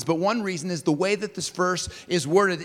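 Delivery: usual speaking voice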